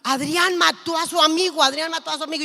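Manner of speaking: loud, urgent cry for mum, retold; imitated tearful panic. Son calling his mother about a killing, acted panic. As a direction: voice the son's alarmed phone call, no real sobs. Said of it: "me llama llorando y me dice".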